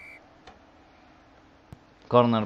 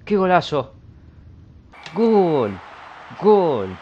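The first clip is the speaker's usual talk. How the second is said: shouted, higher and louder cheer; goal celebration; 5 semitones higher, 3 dB louder, much slower than usual. Real, not acted. Gamer cheering his own goal, loud excited shout. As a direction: yell it like you just scored.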